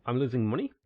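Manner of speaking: ordinary delivery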